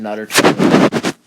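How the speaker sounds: loud, high excited blurt; excited storyteller outburst; sudden loud burst. Real, not acted.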